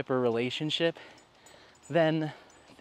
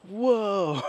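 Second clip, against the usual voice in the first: higher, wide-eyed mock whoa; mock amazed dogs; 7 semitones higher, 4 dB louder than usual. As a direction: voice the awed dogs watching. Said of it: "all the dogs are just staring at him like".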